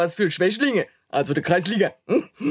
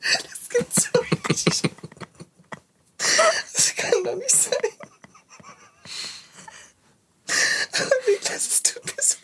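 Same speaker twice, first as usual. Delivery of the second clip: vor Lachen flüsternd; 4 dB quieter than usual. Real, not acted. Lachanfall nach Versprecher.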